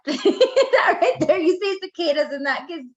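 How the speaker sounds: laughing nervously; giggly, high, half-laughing words. Real, not acted. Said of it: "sorry for all my nervous laughter".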